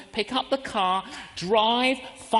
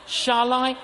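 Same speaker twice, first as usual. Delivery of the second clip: higher, louder shall I; debating aloud, higher; hesitant question, raised; 4 semitones higher, 5 dB louder than usual. Shown as a demonstration. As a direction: ask yourself, lifted up. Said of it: "I get a quiver in my liver".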